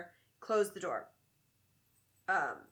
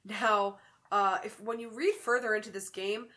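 ordinary talk

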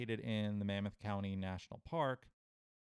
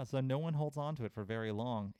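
usual speaking voice

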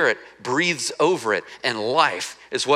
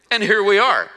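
excited payoff; 7 semitones higher, 6 dB louder than usual. Retold or imitated. Disciples' imagined vindicated reply.

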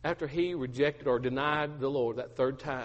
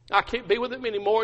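higher, anguished repeat; acted distress; 6 semitones higher than usual. Peter admitting it to his wife, openly distressed. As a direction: raise the pitch in despair. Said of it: "Peter just begins to weep".

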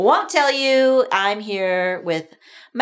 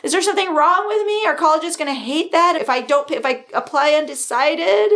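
put-on higher, frantic teenager voice; mock-panicked teenager, higher and rushing; panicked teen; 8 semitones higher than usual. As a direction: play a spiralling, anxious teen. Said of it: "teen stress".

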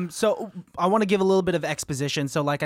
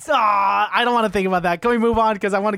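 loud, higher groaning protest; squeamish, pleading objection, pitched up; grossed-out protest; 5 semitones higher, 6 dB louder than usual. Real, not acted. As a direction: complain loudly, trying to shut out a horrible mental image. Grossed out by unwanted mental image.

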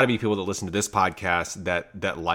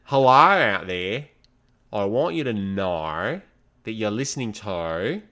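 put-on Australian accent. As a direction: welcome the listeners like an Aussie DJ. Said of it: "some of those Australian words you said were very Australian".

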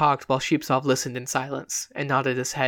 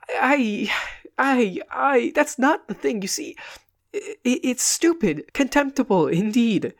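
higher, stammering, agitated friend; flustered comic actor; 8 semitones higher, slower than usual. Acted. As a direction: sputter in distress at his friend. Comic man stammers again.

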